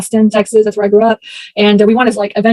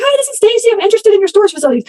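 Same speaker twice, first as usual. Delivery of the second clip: high, raised voice, fast at the end; yelling at a deaf man; 11 semitones higher, faster than usual. Retold or imitated. Calling a deaf old owner about his facility, high raised yelling. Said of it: "I was, like, screaming on".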